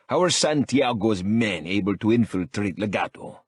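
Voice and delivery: put-on growled in a thick Mexican accent. A narrator's reading. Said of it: "A thick accent growls".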